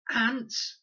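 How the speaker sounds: high, loud disbelieving squeak; can't believe what she heard; incredulous. A narrator's reading. Con scoffing at Fred's plan, high and disbelieving.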